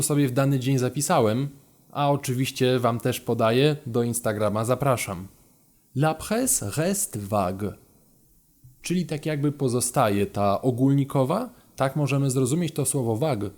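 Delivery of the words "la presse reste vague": slow careful French. Shown as a demonstration. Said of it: "reads the French flashcard".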